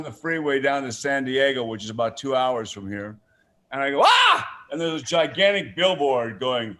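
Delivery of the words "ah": high, loud cry of shock; startled yell. Retold or imitated. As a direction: yelp as if caught by surprise. Startled on seeing billboard.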